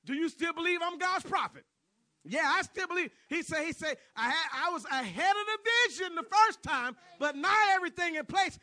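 very loud, high preacher's cry; ringing, full-force pulpit delivery; climactic preaching shout. Real, not acted. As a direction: shout the story's climax to the congregation.

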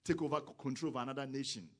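loud, fast, rising fervor; fiery sermon climax; preaching at full force. Real, not acted. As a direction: drive it home like a revival preacher.

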